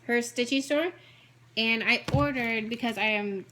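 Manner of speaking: ordinary talk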